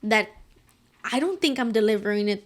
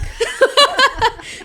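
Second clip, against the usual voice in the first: real laughter burst; 14 semitones higher, 7 dB louder than usual. Real, not acted. Laughing at own retort.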